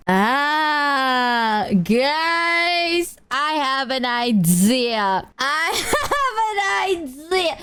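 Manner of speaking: long, drawn-out dramatic exclamation; mock scheming glee. Streamer announcing mischievous idea to chat, hammed-up drawn-out excitement. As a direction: stretch every word, climbing into a high, gleeful cry.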